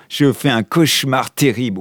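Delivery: ordinary delivery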